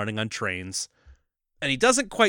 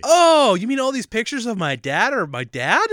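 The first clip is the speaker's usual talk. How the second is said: mock dumb surprise; 8 semitones higher, 4 dB louder than usual. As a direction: act out a dumb realization, high. Mocking dumb realization.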